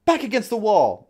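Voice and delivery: loud, high guard's command; shouted order. Acted. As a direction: call it sharply through a cell door. Guard orders prisoner back.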